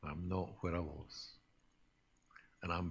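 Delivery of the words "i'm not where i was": much softer, let it sink in; quiet echo. Real, not acted. Preacher echoing his point to the congregation, hushed and soft. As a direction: repeat it under your breath like a private thought.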